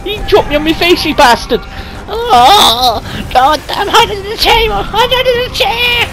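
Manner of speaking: ordinary delivery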